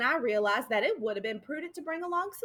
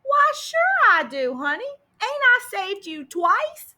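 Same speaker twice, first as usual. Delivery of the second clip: higher, louder, slow and drawn out; hearty loud reassurance; 8 semitones higher, 9 dB louder, slower than usual. A narrator's reading. Sheriff loudly reassures the crying orphan, higher and drawn out. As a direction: boom out comfort to a crying child.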